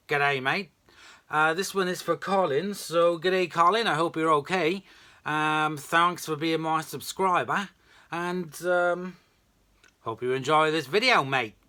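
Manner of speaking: lightly hammed-up Australian accent, pitched a bit up; badly faked Aussie greeting voice. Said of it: "that's my very bad Australian accent".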